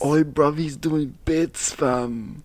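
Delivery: put-on London slang accent. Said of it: "That was my London accent".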